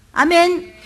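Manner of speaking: normal talking voice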